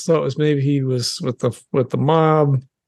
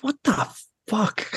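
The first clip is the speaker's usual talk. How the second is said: inner disbelief; 5 semitones higher, 6 dB quieter than usual. Retold or imitated. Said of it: "inside, he's like".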